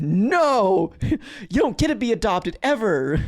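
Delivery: loud, high, long-held no; hammed-up harsh verdict on a kid; mock angry refusal. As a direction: bellow the refusal in mock outrage.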